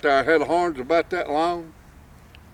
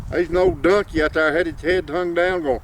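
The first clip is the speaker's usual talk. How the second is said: ordinary delivery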